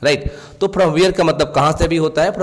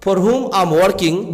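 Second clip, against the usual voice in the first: very slow, word by word, slightly raised pitch; slow example reading; 3 semitones higher, much slower than usual. Shown as a demonstration. Teacher giving students an English example sentence, slow and clear. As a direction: read it out like a dictation. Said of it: "teacher reads English example".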